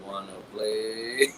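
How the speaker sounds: low, slow creepy whisper-like tease; creepy doll voice. Retold or imitated. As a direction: drag it out slowly to spook someone. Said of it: "creep my sister out and be like".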